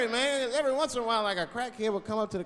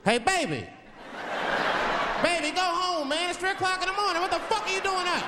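pitched way up, calling from the car window; high, startled caller voice; 13 semitones higher than usual. Retold or imitated. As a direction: holler at the kid through the cracked window. Calling out to a baby in the street.

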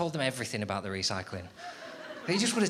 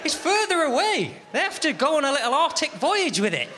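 hammed-up indignation; mock outrage; 11 semitones higher, 5 dB louder than usual. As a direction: blow up at the box in disbelief. Outraged discovery of the box.

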